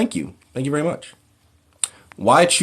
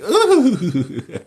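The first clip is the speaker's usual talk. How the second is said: mock swoon; 10 semitones higher, 4 dB louder than usual. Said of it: "she's like".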